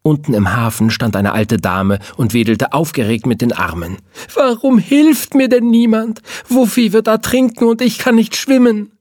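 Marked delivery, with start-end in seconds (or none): 4.3-8.9 put-on high, loud old woman's voice, frantic; old lady pleading, frantic about her dog; panicked old lady. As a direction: wail like a desperate old lady.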